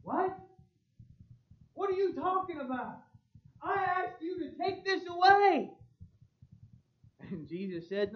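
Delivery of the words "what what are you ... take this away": high, disbelieving outburst; can't believe the answer, voice jumps up; incredulous. Acted. Paul reacting in disbelief to God's refusal, high incredulous protest. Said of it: "the Apostle Paul's reaction was".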